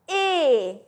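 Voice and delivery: closed, drawn-out vowel on "Et"; closed é sound. Shown as a demonstration. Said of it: "My mouth is like that". ("Et" en é fermé, allongé)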